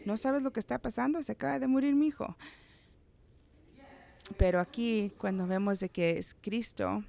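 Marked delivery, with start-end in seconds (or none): 0.0-2.4 fast, higher, indignant retort; angry grieving parent. Retold or imitated. The grieving widow snapping at the one telling her not to cry, angrily. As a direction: snap back as the parent who just lost a son. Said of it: "A lo mejor yo estuviera bien enojado".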